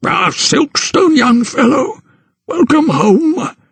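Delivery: put-on a cheery elder welcoming a young man; Doctor Carruthers greeting Thomas, high and hearty; genial old doctor.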